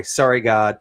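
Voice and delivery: ordinary talk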